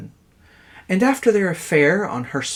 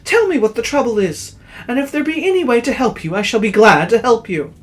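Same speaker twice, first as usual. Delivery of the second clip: high, loud anxious plea; a kind man pleading loudly; pleading merchant; 10 semitones higher, 7 dB louder than usual. Acted. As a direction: beg her to stop crying. Man pleads with weeping woman.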